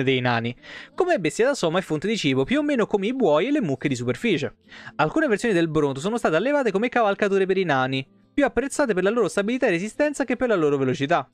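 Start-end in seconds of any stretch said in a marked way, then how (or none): none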